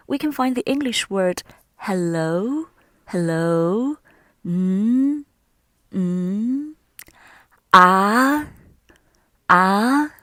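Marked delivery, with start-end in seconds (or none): 1.8-10.1 hello lifting at the end, as in a question; rising tone. Shown as a demonstration. Teacher showing second tone through English hello, pitch rising. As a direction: draw Mm and Ah out, gliding upward. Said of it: "The second one is a rising tone".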